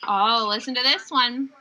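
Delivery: usual speaking voice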